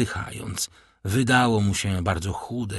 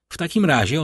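ordinary delivery, nothing marked

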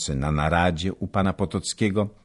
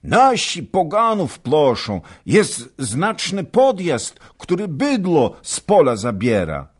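high, loud, agitated; urgent report; 11 semitones higher, 6 dB louder than usual. A narrator's reading. Servant urgently reporting the raid, high and loud. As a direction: report breathlessly.

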